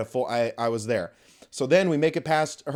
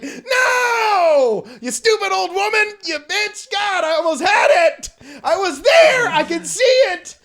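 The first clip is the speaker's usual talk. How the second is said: crying shout, much higher and much louder; anguished shout; 10 dB louder, slower than usual. Retold or imitated. He rages at the old woman, crying shout. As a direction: wail at the lost race like a wounded hero. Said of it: "and I was like".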